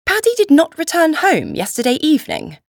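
high, startled question; demanding. A narrator's reading. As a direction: demand it in disbelief, pitch lifted. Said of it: "I demanded".

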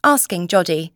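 plain reading voice

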